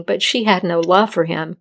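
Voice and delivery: plain reading voice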